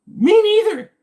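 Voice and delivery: very high, very loud squealed echo; random commenter. Acted. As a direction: shriek it as an over-eager mock commenter. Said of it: "just your random commenter".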